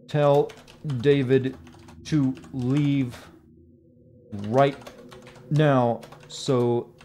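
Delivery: very slow, broken into small chunks; word-by-word dictation with long pauses; dictating while typing. Real, not acted. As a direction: speak only as fast as you type.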